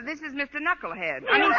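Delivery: ordinary delivery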